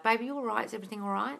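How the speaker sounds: soft, caring check-in; gentle concern. Shown as a demonstration. Coach models the revised breakup scene, gently concerned. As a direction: ask gently, voice lifting at the end. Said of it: "The way you would advise that".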